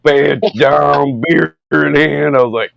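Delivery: ordinary talk, nothing marked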